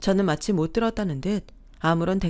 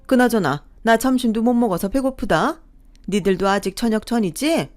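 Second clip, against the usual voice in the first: loud, higher, scolding mother-in-law voice; irritated mother-in-law; 3 semitones higher, 7 dB louder than usual. Acted. Mother-in-law scolding her daughter-in-law for asking why she came, loud and indignant. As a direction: brush off the question with loud indignation.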